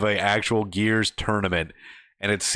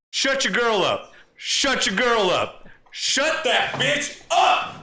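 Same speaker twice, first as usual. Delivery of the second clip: much higher, slow angry demand; mock angry yell; 10 semitones higher, much slower than usual. Gamer telling his rival to silence his girlfriend, angry mock yell. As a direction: spit it out slowly at his rival.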